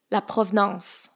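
La provenance with French vowels and a nasal ending; French pronunciation. Shown as a demonstration. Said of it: "exists in French".

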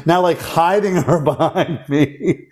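slow, words broken by a real laugh; laughing while telling. Real, not acted. Host retelling the bar fight threat, laughing. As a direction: laugh through the telling of the story.

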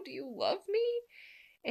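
very high, drawn-out lovelorn question; retold plaintive asking; acted pleading question. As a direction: stretch it out high, as a hesitant plea. Acting vulnerable question in love scene.

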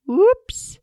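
sudden high yelp of a slip; startled cry as you slide; surprised whoops. Pretend. Slipping on the icy road.

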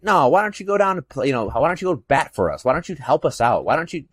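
high-pitched mocking complaint; Jeffrey bitching. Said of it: "Jeffrey was just bitching and bitching".

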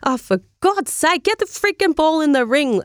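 retold frustration, rising in pitch; frustrated. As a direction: replay your own fed-up impatience, pitch climbing as it goes. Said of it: "I'm getting so frustrated today".

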